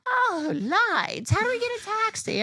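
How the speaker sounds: very high, fast airy mock ditz; ditzy friend. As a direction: squeak it like a clueless tourist. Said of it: "Tabby's like".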